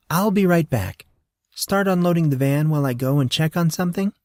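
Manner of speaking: put-on a breezy girl heading off.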